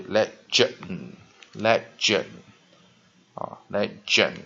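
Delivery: teacher spelling out "legend" for class; slow model pronunciation. Shown as a demonstration. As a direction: model "legend" carefully, syllable by syllable. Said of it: "我们这是画双线在这个地方，le J".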